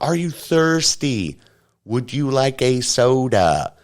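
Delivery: high, slow, over-careful mock voice; slow talking-down. Woman offering the dazed kid a soda, mocked high and slow. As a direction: stretch every word as if the kid can't understand. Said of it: "She's like".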